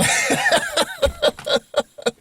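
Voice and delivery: long high-pitched giggle; real helpless laughter; laughing. Real, not acted. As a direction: crack up at your own punchline. Laughs at his own punchline.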